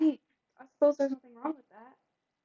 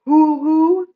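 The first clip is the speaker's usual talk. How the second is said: louder, higher playful hoots; mock hooting; 5 semitones higher, 11 dB louder than usual. Streamer clowning at the tea prep with loud mock hoots. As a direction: hoot it like a goofy owl.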